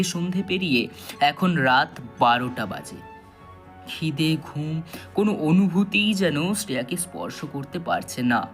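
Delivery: ordinary delivery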